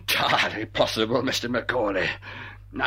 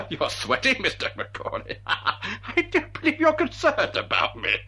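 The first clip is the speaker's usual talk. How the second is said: laughing, higher sneer; mocking laugh; 7 semitones higher than usual. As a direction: laugh at his fear. Villain mocking through laughter.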